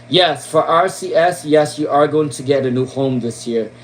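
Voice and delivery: normal talking voice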